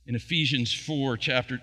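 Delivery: ordinary delivery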